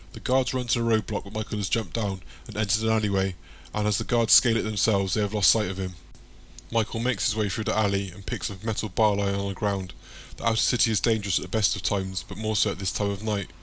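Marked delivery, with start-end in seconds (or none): none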